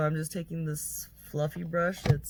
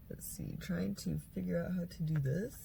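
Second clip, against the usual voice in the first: whispering; 6 dB quieter than usual. Real, not acted. Unwrapping, talking under breath.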